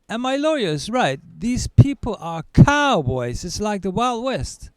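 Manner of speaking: normal talking voice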